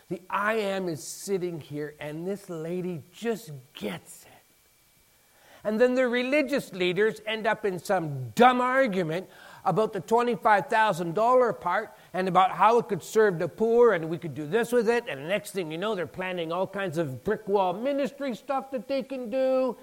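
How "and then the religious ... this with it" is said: ordinary talk, nothing marked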